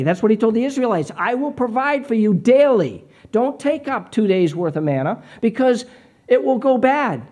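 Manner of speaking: loud, high, fervent preaching; the preacher booms out God's daily provision; preaching at full force. Acted. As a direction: lift the room, proclaim it.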